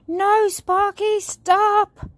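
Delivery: high, loud, drawn-out warning shout; alarmed cry. A narrator's reading. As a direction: call out urgently to stop someone. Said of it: "cried George".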